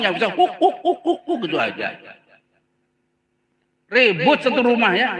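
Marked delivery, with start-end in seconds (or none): 0.3-1.4 high, comic dog barks; short high woofs, mimicking a dog; playful mock barking; imitated dog barking. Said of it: "Ngomong nggak bisa ngomong".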